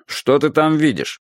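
lightly voiced gruff man, slow; a hint of rough male voice, unhurried; gruff man's voice. A narrator's reading. School watchman crouching to question a boy, lightly gruff. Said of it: "грубым мужским голосом".

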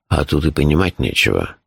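low, composed announcement; deep, unruffled, matter-of-fact voice; imperturbable. A narrator's reading. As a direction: break alarming news without any alarm. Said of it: "невозмутимо сообщил он".

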